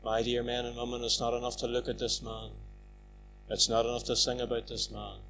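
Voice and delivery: soft, low closing plea; quiet and earnest, pleading; hushed appeal. Real, not acted. Preacher quietly pleading with the men and women, soft.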